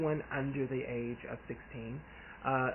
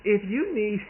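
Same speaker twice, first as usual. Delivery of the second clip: fired up; 5 semitones higher, 5 dB louder than usual. Retold or imitated. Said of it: "I'm like".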